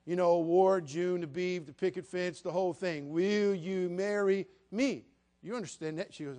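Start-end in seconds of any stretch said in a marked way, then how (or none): 3.1-5.0 each word drawn out, slow and deliberate; a very slow, pointed "Will you marry me?"; slow emphatic. Retold or imitated. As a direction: spell it out slowly like to a child. Repeating the proposal slowly.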